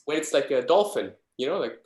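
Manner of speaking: ordinary delivery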